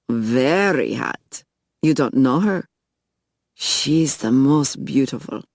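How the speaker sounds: put-on slow, admiring Russian-accented drawl. A narrator's reading. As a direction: savour it slowly in a Russian accent. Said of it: "he asked with a Russian accent".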